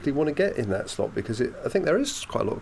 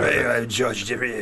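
slow broad Scottish accent; thick Scottish accent; much slower than usual. Shown as a demonstration. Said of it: "thick Scottish accent".

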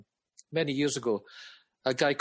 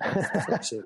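laughing through an exasperated curse; laughing exasperation; 3 semitones higher than usual. Real, not acted. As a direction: laugh it out, fed up. Reacting to the band's song.

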